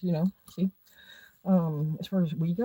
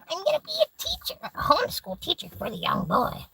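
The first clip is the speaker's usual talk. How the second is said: high, squeaky mocking teacher voice; 4 dB louder than usual. Mocking the friend's teacher plans in a high squeaky voice. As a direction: parody a prissy wannabe homeschool teacher, pitched way up. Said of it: "And you're like".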